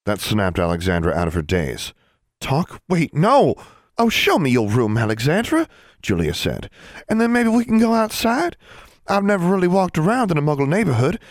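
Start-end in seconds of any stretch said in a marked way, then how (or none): 2.4-5.7 alarmed protest. Acted. Alexandra protests the long talk. 7.1-11.2 put-on eager girl voice. Julia eager to go outside.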